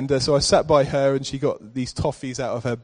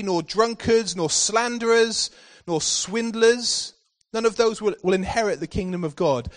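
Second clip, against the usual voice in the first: scripture reading; 6 semitones higher than usual. Acted. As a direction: proclaim each sin in turn.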